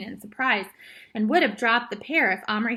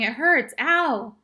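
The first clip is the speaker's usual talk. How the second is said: loud, raised complaint of pain; pained yell; 4 semitones higher, 5 dB louder, slower than usual. A narrator's reading. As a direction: keep yelling that you are hurt. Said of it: "Patrick kept yelling".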